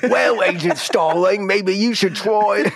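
slow, high, broad Cockney; wise old Cockney sweep. Pretend. Helpful sweep advising Agent Starling, high slow Cockney. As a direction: counsel slowly in a hammed-up Cockney voice. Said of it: "like, the wise old chimney sweep".

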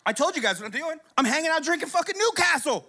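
ordinary delivery

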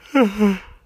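sudden loud burst of laughter; laughing out loud at the silly dream. Real, not acted. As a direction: break into a big laugh.

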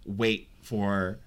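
usual speaking voice

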